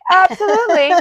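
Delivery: high, loud eager yes; excited agreement. Retold or imitated. Her own agreement retold.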